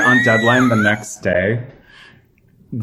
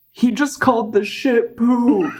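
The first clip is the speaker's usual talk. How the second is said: much higher, slow goofy voice; dopey friend; 14 semitones higher, slower than usual. Retold or imitated. Billy's friend laughing at the old man, high goofy. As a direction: say it like a giggling dim kid. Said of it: "one of them goes".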